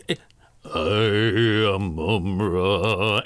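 slow, drawn-out villain line; Mumra voice. Retold or imitated. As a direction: boom it slowly like a mummy lord. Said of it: "It's just that the entire time".

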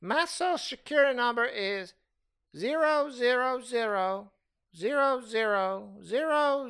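put-on creaky old lady, dragging the zeros. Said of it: "the old lady voice I do".

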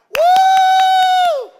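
loud, high mock parent's whoop; shrieked "woo!"; whooping cheer.

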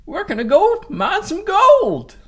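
high, slow creaky prospector voice; very old man. Pretend. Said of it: "the old man voice, that is the gold mining voice".

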